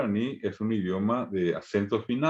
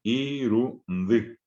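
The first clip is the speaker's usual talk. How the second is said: Iru then ndi with a clear pause; teacher splitting Iru, ndi; split syllables; much slower than usual. Shown as a demonstration. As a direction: show where Irundi breaks, iru and ndi. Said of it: "Esa es la forma en que en guaraní se sila".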